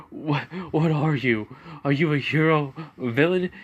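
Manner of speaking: high, fearful questioning; terrified. Acted. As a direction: ask in rising panic. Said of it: "As they're like".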